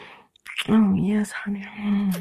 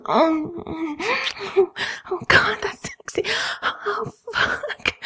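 moaning; 11 semitones higher, slower than usual. Acted. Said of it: "and moan".

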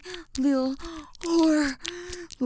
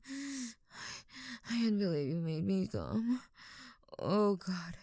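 usual speaking voice

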